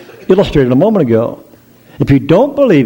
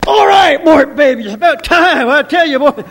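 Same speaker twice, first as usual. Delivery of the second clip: eager high shout; 12 semitones higher than usual. Acted. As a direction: shout it like she's waited forever. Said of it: "you'd say".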